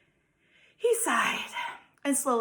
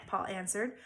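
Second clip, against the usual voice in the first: plain reading voice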